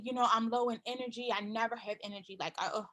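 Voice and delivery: low, soft, drained voice, dragging each complaint out; tired customer. Pretend. A customer moaning to the seller about no energy, low and soft. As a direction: play a worn-out client who can't sleep. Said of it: "or maybe they say".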